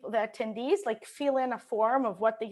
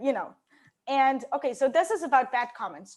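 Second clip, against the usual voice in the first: ordinary talk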